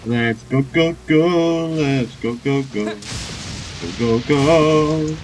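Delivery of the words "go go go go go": usual speaking voice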